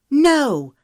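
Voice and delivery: high, loud shouted no; fevered cry. Acted. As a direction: burst out at a fevered pitch. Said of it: "Her voice rose to a fevered pitch".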